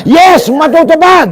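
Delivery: high, slow rallying shout; demonstrated loud cheer. Shown as a demonstration. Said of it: "Lakasan nyo".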